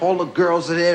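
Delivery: ordinary talk, nothing marked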